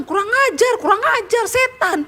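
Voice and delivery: high squawking parrot voice; rude parrot squawk. Acted. Preacher voicing the rude parrot's squawking curse. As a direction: screech the insult like a parrot. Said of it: "dia bilang".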